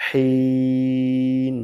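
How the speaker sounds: সলিহিন with a heavy emphatic sad, held very long; heavy sad, drawn out. Shown as a demonstration. Teacher models the correct word very slowly. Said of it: "বলতে হবে".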